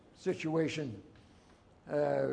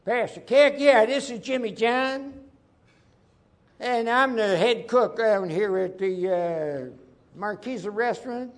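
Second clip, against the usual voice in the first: higher, louder put-on cook voice; prank caller; 5 semitones higher, 4 dB louder than usual. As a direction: fake a cheery restaurant cook on the phone. Prankster phoning as restaurant cook.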